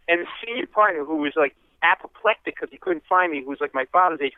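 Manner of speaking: normal talking voice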